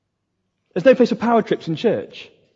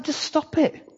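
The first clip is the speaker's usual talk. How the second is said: soft, much higher stop it; quiet, high exasperated plea; hushed exasperated plea; 9 semitones higher, 6 dB quieter, slower than usual. Real, not acted. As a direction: sigh it out softly, pleading. Pastor rebukes rival church gossip.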